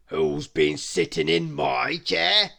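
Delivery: loud, slow big bear; loud, slow bear question. A narrator's reading. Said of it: "said the big bear".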